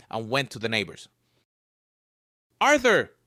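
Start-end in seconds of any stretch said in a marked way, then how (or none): 2.6-3.2 high, loud call. Acted. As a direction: call through the window. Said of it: "she called through the window".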